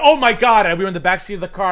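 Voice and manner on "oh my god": high, loud shriek of shock; shocked scream. Retold or imitated. Recalling the backseat shock with a loud high shriek. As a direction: shriek it out, horrified. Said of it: "And I went".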